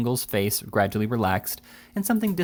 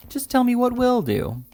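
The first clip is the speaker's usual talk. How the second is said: higher, slow, coaxing plea; fussy little attorney; 4 dB louder than usual. A narrator's reading. Attorney begs Jingle to name his price, higher and slow. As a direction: draw it out, begging him to name it.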